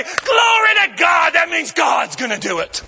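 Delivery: high, loud preacher's build to a shout; preaching at full force. Acted. Preacher promising the congregation healing, shouting. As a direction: drive it home to the congregation.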